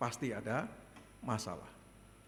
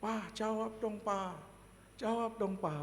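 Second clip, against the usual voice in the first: lightly voiced, higher-pitched child's plea; imitating pleading child; 6 semitones higher, slower than usual. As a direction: nag dad for an answer. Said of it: "Sama seperti anak saya berkata". (menirukan anak memohon pada ayahnya)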